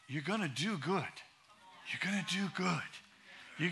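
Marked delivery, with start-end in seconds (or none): none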